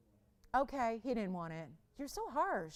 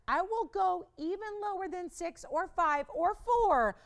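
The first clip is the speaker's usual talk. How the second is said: higher, louder, building sales hype; rousing seller's countdown; excited price pitch; 8 semitones higher, 5 dB louder than usual. Real, not acted. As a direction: push the price drop hard, pitched up and loud. Seller hypes an ever lower price.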